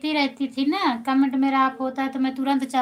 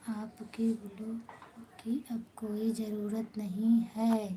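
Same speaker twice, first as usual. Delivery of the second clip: slow, soft whispered aside; 2 semitones lower, 9 dB quieter, slower than usual. Real, not acted. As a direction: drop to a whisper, slowly. Sudden aside to Poonam didi.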